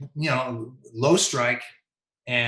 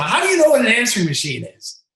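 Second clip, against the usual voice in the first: high, loud yell to a heckler; 9 semitones higher, 6 dB louder than usual. Retold or imitated. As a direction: holler it back into the stands. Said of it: "I even yelled to him".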